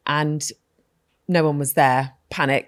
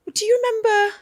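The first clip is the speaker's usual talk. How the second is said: much higher mum voice; imitating mum; much faster than usual. Daughter imitating her mother reminiscing, high and lilting. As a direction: put on her mum's sing-song voice. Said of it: "she was like".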